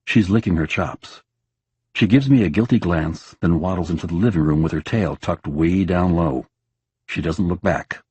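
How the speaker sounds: usual speaking voice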